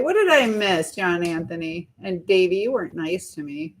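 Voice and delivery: ordinary talk, nothing marked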